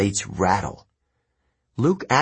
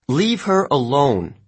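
protective demand; 5 semitones higher, 4 dB louder than usual. A narrator's reading. Percy defending Annabeth.

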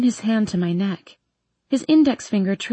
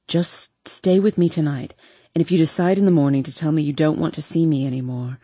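low pleading man's voice; 6 semitones lower than usual. A narrator's reading. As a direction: beg her to stay, low and raw. Hardin begs her.

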